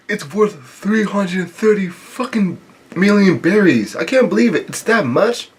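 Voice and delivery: stunned disbelief. Acted. Acted disbelief at huge bounty.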